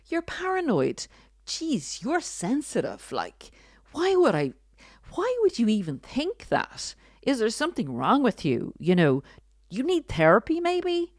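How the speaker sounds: high-pitched, scoffing disbelief; hammed-up dismissive narcissist, pitch leaping up; mock-incredulous gaslighting. Narcissist answering your doubts, incredulous and dismissive. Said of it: "and they say".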